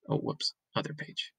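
ordinary delivery

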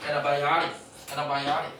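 "antibiotic" as "ena-bai-ari"; American-style reduced "antibiotic", repeated for learners. Shown as a demonstration. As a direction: drop the hard t's in "antibiotic". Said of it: "그래갖고 에나 이렇게 발음돼".

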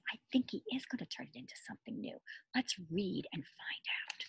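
fast conspiratorial whisper. Acted. Teacher whispering a prediction to the class. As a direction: share it as a hushed secret.

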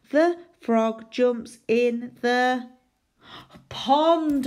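slow, careful read-back. Shown as a demonstration. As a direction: drag each word as you write. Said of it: "reading back while writing".

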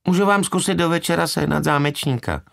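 high, monotone clerk voice; young woman on the phone; bored receptionist. A narrator's reading. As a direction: offer it in a high, bored drone. Bored receptionist's acted line.

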